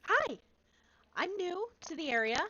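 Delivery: put-on high, small, eager little-girl voice; little shy girl. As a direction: pipe up, tiny and nervous, new to everything. Small shy character meets shopkeeper.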